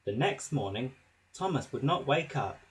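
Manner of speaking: ordinary delivery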